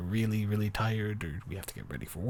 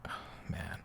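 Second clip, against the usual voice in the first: very soft whispered sigh of sympathy; 10 dB quieter than usual. Acted. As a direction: barely breathe it out. Sympathising with a sleepless partner.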